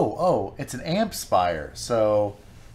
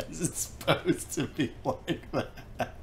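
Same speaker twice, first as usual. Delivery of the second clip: laughing through the question; laughing echo; 3 semitones higher, 8 dB quieter, slower than usual. Real, not acted. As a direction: repeat it slow and soft through laughter. Echoing the joke, laughing.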